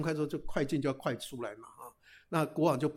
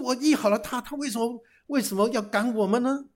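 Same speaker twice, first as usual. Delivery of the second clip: indignant protest; 6 semitones higher, 4 dB louder than usual. Acted. Brothers protest being thrown out.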